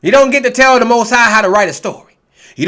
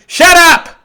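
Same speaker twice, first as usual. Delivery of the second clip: snapping; 6 semitones higher, 4 dB louder than usual. Real, not acted. Snapping at critics.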